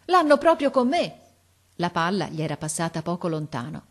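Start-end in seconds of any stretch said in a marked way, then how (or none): 0.1-1.2 high, loud protest; indignant exclamation. A narrator's reading. Boy under fire in tree, exclaiming. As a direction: cry it out after a near miss. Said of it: "esclamò".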